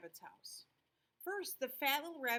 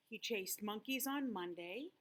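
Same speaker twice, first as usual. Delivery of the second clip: usual speaking voice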